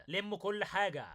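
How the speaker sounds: usual speaking voice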